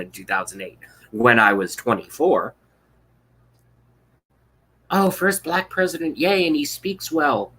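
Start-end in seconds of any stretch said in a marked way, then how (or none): 4.9-7.5 high, slowed, gushing fake cheer; mock naive enthusiasm. Mocking his own 2008 vote with gushy cheer. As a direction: play your clueless younger self swooning.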